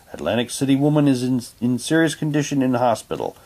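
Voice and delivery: usual speaking voice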